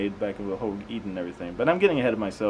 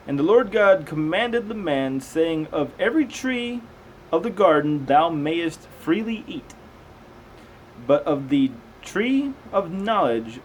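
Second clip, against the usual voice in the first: raised, slow Bible reading voice; slow, measured scripture reading; 4 semitones higher, 4 dB louder, slower than usual. Acted. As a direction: read it out like a careful lesson.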